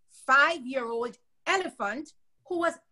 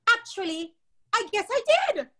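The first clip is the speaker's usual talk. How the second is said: sheepish elephant; 9 semitones higher than usual. A narrator's reading. Ronald admits to the mouse.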